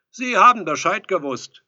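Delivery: high, very loud retort; loud reproach. A narrator's reading. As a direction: call the reproach through the door. Visitor accuses through the door.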